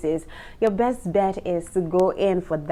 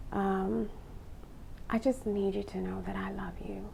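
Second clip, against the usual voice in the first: hushed and intimate, much softer; soft sexy voice demo; 11 dB quieter than usual. Shown as a demonstration. Showing women how to sound seductive, a soft murmur. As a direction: murmur it like a secret for a lover. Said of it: "experiment with lowering the pitch of your own voice".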